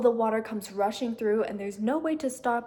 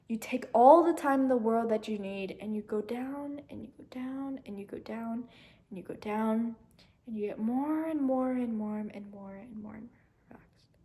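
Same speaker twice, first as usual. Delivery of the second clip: soft, fading calming guide voice; guided meditation; 5 dB quieter than usual. Acted. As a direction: lead them down into calm, softer each time. Guides breathing to calm.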